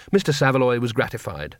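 usual speaking voice

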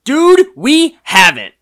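loud, high, drawn-out dude; loud surprised realization; excited outburst. Real, not acted. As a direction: blurt it out amazed and stretched.

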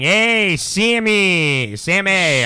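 playful shout of the nickname; calling out. Retold or imitated. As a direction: call out across the room. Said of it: "on YouTube saying".

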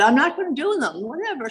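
dismissive, high-pitched refusal; defiant refusal. Retold or imitated. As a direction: act out digging your heels in. Acted refusal to sponsor.

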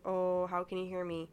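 ordinary talk, nothing marked

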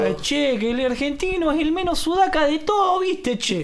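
hammed-up Argentine; Argentine accent. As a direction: preen like a smug porteño. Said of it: "Nos están imitando".

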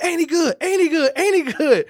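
high repeated urging question; high, insistent praise call. Retold or imitated. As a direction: press him to say it. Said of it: "as I was saying it".

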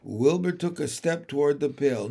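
usual speaking voice

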